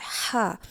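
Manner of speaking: हा from the middle of the throat; throaty ha. Shown as a demonstration. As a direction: say हा as Arabic ح. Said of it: "हलक के दरमियान से अदा किया जाता है".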